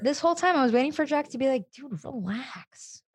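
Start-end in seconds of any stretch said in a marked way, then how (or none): 1.7-3.0 very slow, hushed drawl; imitated laid-back exasperation, stretched and quiet; mock chill-out. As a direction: draw it out softly as if talking someone down. Imagined calming reply to pushy mentor.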